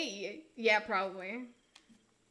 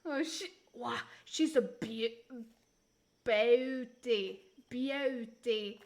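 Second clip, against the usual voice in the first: put-on slow, drawn-out Australian accent; Steve Irwin; much slower than usual. Admiring a wild animal, mock Australian accent. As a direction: marvel in a broad Aussie drawl. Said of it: "Steve Irwin".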